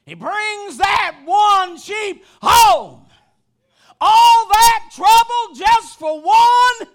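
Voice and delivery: loud, high preacher's shout, drawn out at the peaks; full-force preaching. Real, not acted. Preacher telling the flock they belong to him, full-force preaching. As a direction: build from urgent preaching to a held-out shout.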